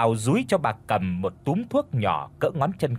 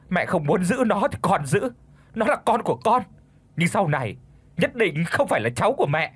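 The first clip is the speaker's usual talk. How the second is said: defiant anger; 6 semitones higher than usual. Acted. Confrontation with mother-in-law.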